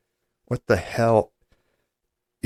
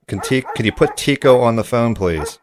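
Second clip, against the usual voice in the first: calling out; 3 semitones higher, 7 dB louder than usual. Acted. Calling to someone on a bad line.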